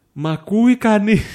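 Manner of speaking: loud, high, drawn-out shout in play; calling out. Pretend. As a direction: call out slowly to whoever might hear. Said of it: "Φωνάζω". (δυνατό, παρατεταμένο φώναγμα)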